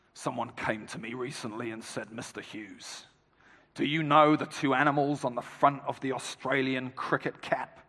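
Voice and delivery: low, hushed imitation of an Australian cricket captain; imitating Kim Hughes. Former captain addressing a cricket dinner, low gravelly Australian imitation. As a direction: retell it quietly, pitched well down, as the Australian speaker. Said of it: "in his gravelly Australian voice".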